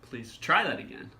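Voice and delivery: "try" jumps up in pitch; stress on "try". Shown as a demonstration. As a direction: punch the word "try". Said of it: "different inflection on the words".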